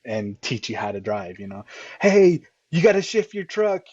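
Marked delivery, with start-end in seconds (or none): 2.0-3.8 high, loud mock advice; mock heckling. Coach mimicking fans telling a driver what to do, mock heckling. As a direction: call out like a pushy bystander.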